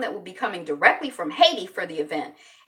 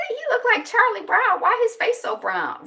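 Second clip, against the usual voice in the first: high-pitched, louder mock disbelief; retold surprised reaction; 7 dB louder than usual. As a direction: pitch it way up, as if taken aback. First reaction to crush, retold.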